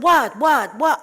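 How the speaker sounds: loud, high repeated what; irritated shout. Acted. Rabbi woken by knocking at his door, irritated shout. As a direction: fire it out at full volume.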